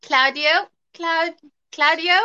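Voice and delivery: "Claudio" as CLOUD-ee-oh, high with a questioning rise; tentative, rising "Claudio"; trying pronunciations. Shown as a demonstration. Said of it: "let me hear the variations here".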